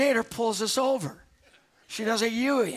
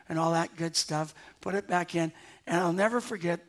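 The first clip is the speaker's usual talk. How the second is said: usual speaking voice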